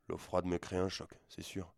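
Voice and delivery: ordinary delivery, nothing marked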